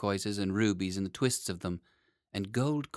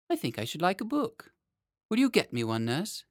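put-on high, lilting child's request; boy king Lionel, politely asking; 9 semitones higher than usual. A narrator's reading. Lionel asking his nurse for a book, polite high boy voice. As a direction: ask sweetly in a higher little-boy voice.

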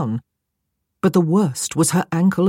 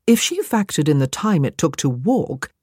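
usual speaking voice

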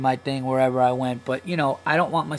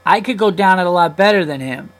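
higher, louder mimicry of a bragging kid; boastful four-year-old; 4 semitones higher, 6 dB louder than usual. Four-year-old bragging to the dad at the slide, mock boastful. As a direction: imitate a little kid showing off, louder. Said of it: "four-year-old runs over, and he goes".

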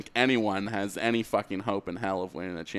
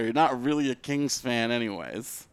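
ordinary delivery

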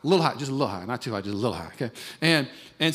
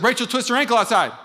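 louder, higher alarm; alarmed report; 6 semitones higher, 5 dB louder than usual. Retold or imitated. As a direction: sound the alarm. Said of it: "if somebody says".

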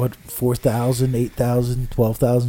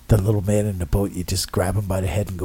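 normal talking voice

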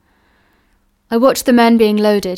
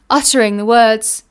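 ordinary delivery

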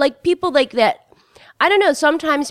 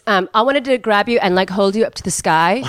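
ordinary delivery

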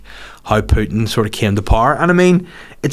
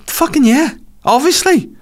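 much higher, mocking disbelief; sarcastic obviously. Real, not acted. Reacting to the war crimes news, high sarcastic squeak.

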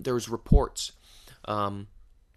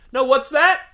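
loud, much higher shout down the hall; calling out loud; 12 dB louder than usual. Real, not acted. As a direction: yell back at the noisy neighbours. Yelling back at distant shouters.

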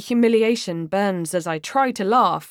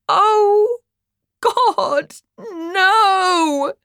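dawning horror; 3 semitones higher, 4 dB louder, much slower than usual. Acted. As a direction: build from stunned murmur into a panicked wail. Dawning horror at a mis-sent text.

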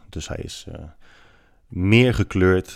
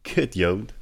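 imitating a neonazi; slower than usual. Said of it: "neonazi tegen mij zegt".

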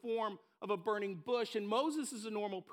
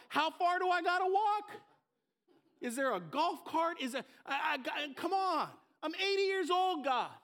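put-on old Moses complaining; 5 semitones higher than usual. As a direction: whine like an old man with a bad hip. Eighty-year-old Moses with arthritic hip.

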